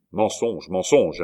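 lively, mildly emphatic interjection; brisk interruption. A narrator's reading. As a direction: cut in briskly with a denial. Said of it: "interrompit vivement le Béarnais".

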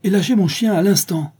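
high, loud, imperious order; commanding. A narrator's reading. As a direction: demand instant obedience, loudly. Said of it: "commanda-t-elle en se tournant". (ordre fort et impérieux)

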